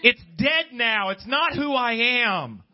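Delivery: rising, fervent preacher's cry; preaching at full force. Acted. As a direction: build it into a passionate sermon climax. Preaching builds to a climax.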